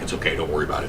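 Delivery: very low, soft imitated brush-off; hushed reassurance. Bystanders calming someone who heard screams, low soft reassurance. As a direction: murmur it low, dismissing any worry.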